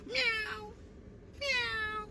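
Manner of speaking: a tiny cat mewing; mock cat meow. Mocking Woody's threats, soft high meow. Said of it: "I hear a cat meowing".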